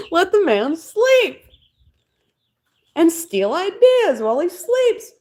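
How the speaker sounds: high, drawn-out mock protest; slow, high-pitched comic plea; mock indignation. Streamer jokingly defending Edison's naps, mock indignant.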